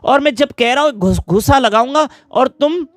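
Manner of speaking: angry husband. Retold or imitated. Husband scolds his laughing wife over a missed train, indignant. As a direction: play a husband mildly fuming and demanding answers. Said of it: "मुझे इतना गुस्सा आ रहा है".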